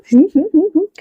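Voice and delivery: a light, high giggling laugh; high giggle. A narrator's reading. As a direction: giggle at overhearing it. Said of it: "वो हंस रहा था". (ऊँची खिलखिलाती हंसी)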